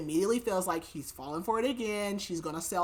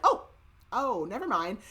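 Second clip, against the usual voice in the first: mock startled oh, then backing off; awkward retold surprise; 3 semitones higher, 5 dB louder than usual. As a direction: act out walking in on a couple. Man walks in on embracing couple.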